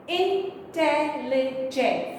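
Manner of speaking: Intelligent said slowly, syllables separated. Shown as a demonstration. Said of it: "four syllables".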